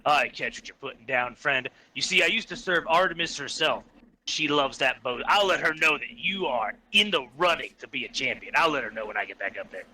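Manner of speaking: very low, measured character voice; friar voice. Acted. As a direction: play the friar far down in pitch. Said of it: "be like".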